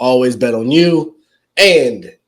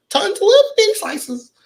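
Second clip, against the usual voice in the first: put-on high, teeny little voice for "little big slices"; mocking high-pitched littleness; tiny-slices voice. Mocks partner's tiny slices of love.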